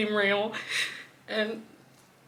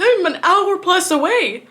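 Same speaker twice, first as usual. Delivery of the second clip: high, loud, slow, half-laughing disbelief; a real, stunned cry that this can't be happening; frantic disbelief; 9 semitones higher, 7 dB louder than usual. Real, not acted. Reliving hearing of cat's death.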